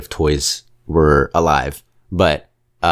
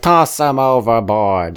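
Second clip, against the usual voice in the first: slower, a bit higher, gleeful; imitating Potato Head; 5 semitones higher, slower than usual. Potato Head urging the toys on, lightly voiced. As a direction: egg on the crowd to violence. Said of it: "he's the one that's like".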